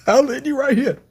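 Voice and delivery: high, excited repeat of the line; hyped repeat. Retold or imitated. Repeating Ichigo's threat, high hyped delivery. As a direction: throw the line out again all fired up.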